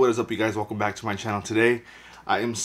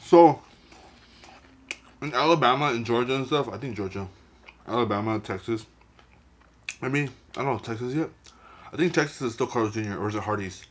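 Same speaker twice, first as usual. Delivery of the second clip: chewing food between words; muffled speech while eating; talking with mouth full; faster than usual. Real, not acted. Seen 4: Cheeks full, lips chewing while holding burger throughout the speech.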